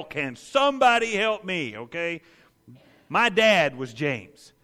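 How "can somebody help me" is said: mock yell in store.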